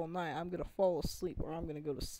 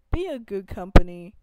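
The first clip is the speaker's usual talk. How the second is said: loud demand; 17 dB louder than usual. Real, not acted. Ranting directly at EA.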